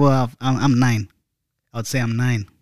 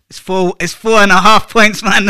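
insistent; 7 semitones higher, 7 dB louder than usual. Real, not acted. Telling friends his score, building to a loud insistence. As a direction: insist on the score, rising to a loud, higher push.

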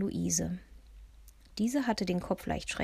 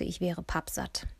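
ordinary delivery

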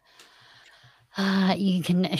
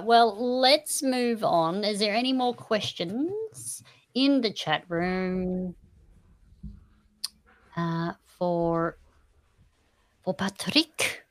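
slow put-on Swedish accent; 2 semitones higher, slower than usual. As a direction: stretch the words in a Swedish accent. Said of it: "That was my best Swedish accent for you".

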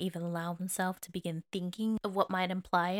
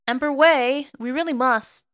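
loud, high and sharply impatient; scalding; 5 semitones higher, 11 dB louder than usual. Acted. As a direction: scold the emperor through the door. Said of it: "tone was almost scalding now".

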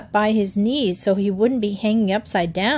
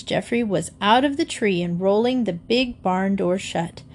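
plain reading voice

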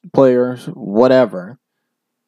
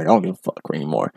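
imitating defiant coach; 7 semitones lower, 6 dB quieter, faster than usual. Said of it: "he's like".

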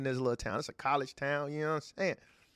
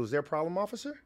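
higher, overly polite deference; polite cop voice; 4 semitones higher, faster than usual. Retold or imitated. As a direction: sweet-talk the officer carefully. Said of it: "I got a different type of voice".

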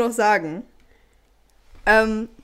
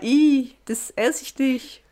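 disgusted; 3 semitones higher, much slower than usual. Retold or imitated. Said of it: "wirklich so war".

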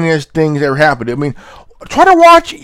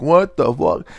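normal talking voice

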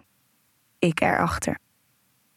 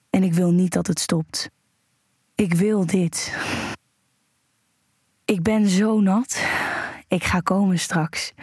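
aroused narration. Acted. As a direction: let the pleasure build as you tell it. Aroused first-person erotic narration.

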